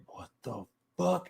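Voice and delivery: soft, stunned aside; hushed disbelief; inner reaction. Retold or imitated. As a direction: say it under your voice, as a private thought. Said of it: "in my head".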